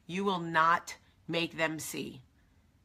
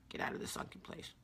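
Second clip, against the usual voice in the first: eerie whisper; 13 dB quieter than usual. Acted.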